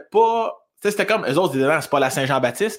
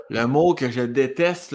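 normal talking voice

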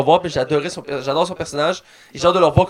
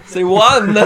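put-on Juan with a Spanish accent; 3 semitones higher, 6 dB louder than usual.

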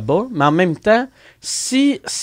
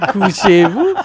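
higher mock command through laughter; parody host voice; 4 semitones higher, 4 dB louder, slower than usual. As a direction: parody the host while laughing, end lifted. (parodie d'animatrice en riant, finale montante)

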